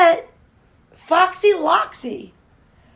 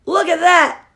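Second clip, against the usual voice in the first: ordinary delivery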